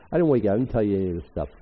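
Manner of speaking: usual speaking voice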